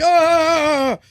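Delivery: long high-pitched mock wail; frustrated cry. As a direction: let out a high, strained cry. Acts out comic's reaction to edits.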